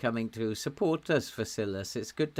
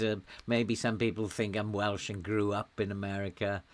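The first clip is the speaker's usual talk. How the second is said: ordinary talk, nothing marked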